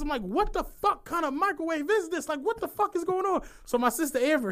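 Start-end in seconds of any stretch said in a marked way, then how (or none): 0.2-3.4 high-pitched exasperated rant; worked-up, incredulous complaint; pissed off. Retold or imitated. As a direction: retell being pissed, pitch pushed right up. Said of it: "So I'm pissed".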